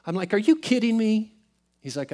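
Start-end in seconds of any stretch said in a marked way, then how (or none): 0.3-1.3 higher-pitched retold disbelief. Man doubting his friend's euphoric claim, disbelief. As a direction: question him in mock disbelief.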